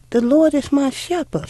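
put-on a little girl tugging at her mother's attention; young girl pleading. Little girl asking her mother to say the whole psalm, eager and high.

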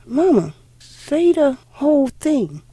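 put-on a little girl tugging at her mother's attention; young girl pleading. Little girl asking her mother to say the whole psalm, eager and high.

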